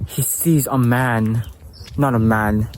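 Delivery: ordinary delivery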